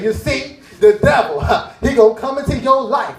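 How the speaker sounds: hammed-up preacher sermon; imitating a preacher. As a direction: preach it like a pastor warning the flock. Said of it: "You say".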